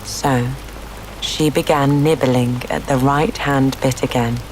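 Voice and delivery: ordinary delivery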